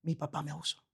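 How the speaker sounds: hushed, ashamed confession; quiet confession. Retold or imitated. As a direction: softly voice a broken confession. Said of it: "me dice".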